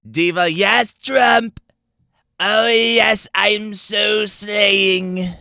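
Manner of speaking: put-on high, loud, slow valley girl voice; Valley Girl Trump. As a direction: gush like a sassy diva. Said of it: "Valley Girl Trump".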